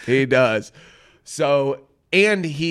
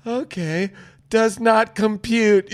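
higher, slow, uncomprehending reply; mock stunned processing; 8 semitones higher, 4 dB louder, slower than usual. Podcaster acting out jilted contestants hearing the news, slow high bewilderment. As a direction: stall on the words as if overloaded. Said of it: "you see them go".

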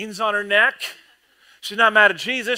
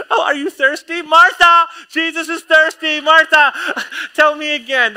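much higher and louder, calling out; bossy swooning Mary; 10 semitones higher, 9 dB louder than usual. Retold or imitated. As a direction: call the sister over while doting.

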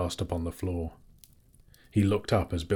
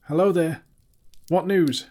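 high, loud, shaky call; 11 semitones higher, 7 dB louder than usual. A narrator's reading. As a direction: call out with a trembling voice. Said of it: "with a shaky voice".

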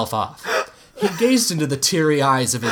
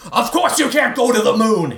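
angry dad yelling; 11 semitones higher, 3 dB louder than usual. Acted. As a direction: yell it like a fed-up father. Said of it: "he yelled".

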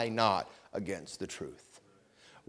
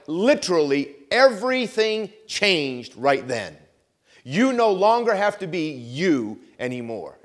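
preaching at full force; 3 semitones higher, 10 dB louder than usual. Acted. Preacher proclaims God's promise of a new heart, loud and driving. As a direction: fire up the congregation with the good news.

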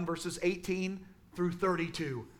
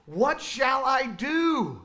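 mock anguished cry, higher and slow; drawn-out despairing plea, imitated; half-acted lament; 5 semitones higher, 4 dB louder, much slower than usual. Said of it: "He cries out".

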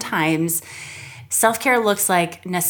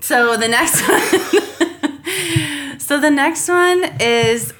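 high-pitched words broken by laughter; cracking up; 8 semitones higher, 4 dB louder, much slower than usual. Real, not acted. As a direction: try to carry on through giggles. Cracks up while moving on.

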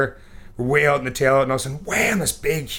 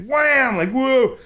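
dazed whoa; 12 semitones higher, much slower than usual. Retold or imitated. Retelling dazed fall.

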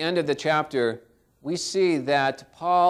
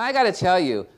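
ordinary delivery

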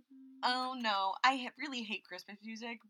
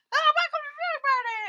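high, loud, hammy welcome; overexcited welcome; 12 dB louder than usual. Retold or imitated. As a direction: burst in overly bright and loud. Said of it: "we're not like".

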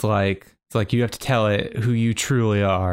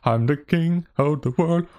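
a hint of a grand, higher boast; pompous king; 6 semitones higher than usual. [name] echoing Lizzie's king voice, a mild mock boast. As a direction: proclaim it like a smug ruler, lightly. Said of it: "That wasn't anything".

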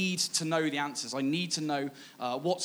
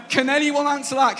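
much higher and louder, amazed; excited disbelief; 8 semitones higher, 8 dB louder than usual. Real, not acted. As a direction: throw the question out to the room, thrilled. Amazed by absurd search result.

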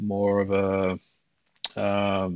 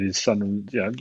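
ordinary talk, nothing marked

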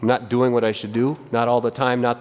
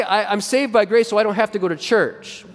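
mock excuse; 7 semitones higher than usual. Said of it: "and yet we say".

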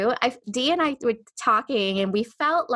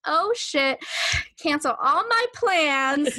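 higher, hammed-up panic; mock dramatic excitement; 6 semitones higher, slower than usual. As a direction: throw your plans away with glee. Mock dramatic reaction to book.

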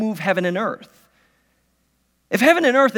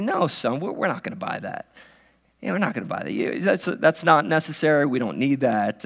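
a hint of a firm dad, lower and softer; stern dad; 7 semitones lower, 5 dB quieter than usual. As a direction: calmly shut the kid down, quietly. Dad refusing son's request.

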